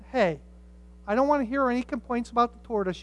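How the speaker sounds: ordinary delivery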